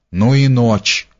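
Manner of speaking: usual speaking voice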